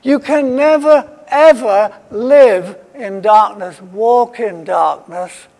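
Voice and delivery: rising emphasis that settles into a slow finish; emphatic never. Shown as a demonstration. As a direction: lean hard on never, ever. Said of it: "It's that sort of emphatic".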